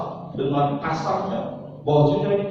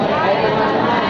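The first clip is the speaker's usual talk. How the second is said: rallying call; 8 semitones higher, 7 dB louder than usual. Shown as a demonstration. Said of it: "siap praktek?".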